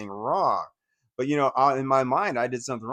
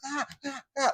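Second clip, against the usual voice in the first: high, quieter mock cries; short high protest yelps from a freaked-out child; imitated yelp; 14 semitones higher, 5 dB quieter than usual. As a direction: replay a child's yelling at reduced volume. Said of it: "I'm like yelling, like that".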